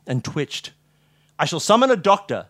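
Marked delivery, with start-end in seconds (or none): 1.4-2.5 firm, raised, authoritative announcement; commanding. A narrator's reading. Holmes takes charge of his collapsed friend, commanding. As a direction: take charge with a louder voice. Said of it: "in a commanding voice".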